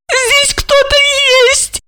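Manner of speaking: very high, pitiful squeal; plaintive squeak. A narrator's reading. Olga finds someone there, plaintive squeak. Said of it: "жалобно пропищала Ольга".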